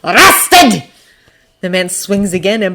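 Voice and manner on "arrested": raised, forceful half shout; loud, high single-word outburst. A narrator's reading. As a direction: bark it out over a fight. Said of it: "as he half shouts".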